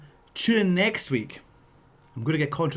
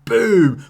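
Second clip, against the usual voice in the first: punchy imitated impacts, louder; loud mock chop sounds; 5 semitones higher, 9 dB louder than usual. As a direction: hammer out each chop. Chop hits Aspen's face.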